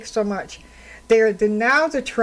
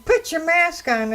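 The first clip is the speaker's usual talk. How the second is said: mild mock yell, pitched higher; 7 semitones higher than usual. Mother yelling at me in the store, mild mock yell. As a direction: bark it like an angry mother. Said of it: "A mother yells at me".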